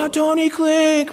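high, slow, drawn-out echo of the name; lightly voiced crowd going oh Tony Klink; mock crowd echo.

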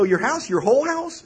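high, loud, mock-appalled questioning; incredulous. Brother balking at his sister's request, incredulous.

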